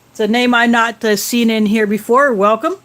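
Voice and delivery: normal talking voice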